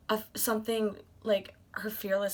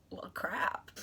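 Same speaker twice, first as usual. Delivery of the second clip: softly whispered resigned curse; hushed oh-no whisper; 3 semitones lower, 7 dB quieter than usual. Retold or imitated. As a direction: whisper it like you've been caught. Caught out, hushed aside.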